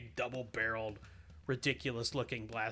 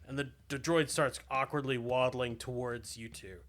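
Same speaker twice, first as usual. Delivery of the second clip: ordinary talk, nothing marked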